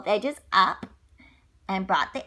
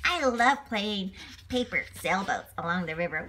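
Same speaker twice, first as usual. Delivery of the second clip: usual speaking voice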